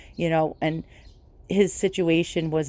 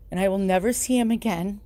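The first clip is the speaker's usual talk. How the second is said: normal talking voice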